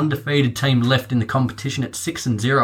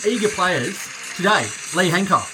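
upbeat, high game show host; 4 semitones higher than usual. Pretend. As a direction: welcome the contestants like a TV host. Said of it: "Your game show host voice is top notch".

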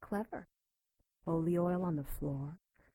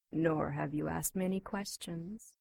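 normal talking voice

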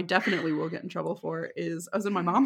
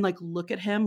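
normal talking voice

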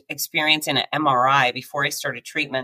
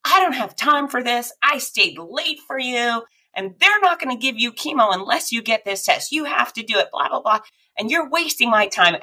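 higher, a mock irritated scolding; imitating an angry tech; 7 semitones higher than usual. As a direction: play the impatient tech scolding her. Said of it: "she's yelling at me".

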